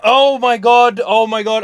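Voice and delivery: exasperated. Retold or imitated. Exasperated at wife not looking.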